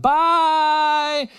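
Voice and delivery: put-on high, sing-song little-girl "Bye"; toddler's bye. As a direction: call bye like a tiny daughter. Toddler daughter saying bye.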